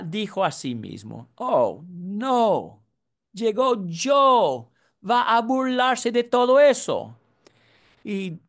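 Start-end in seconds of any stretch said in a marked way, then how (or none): 1.4-7.2 dismayed. Retold or imitated. Father's dismay, Joe arriving.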